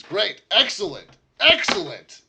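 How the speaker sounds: high, loud delighted cry; excited lord. Acted. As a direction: burst out thrilled. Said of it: "he's obviously very excited".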